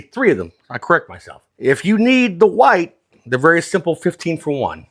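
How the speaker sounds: usual speaking voice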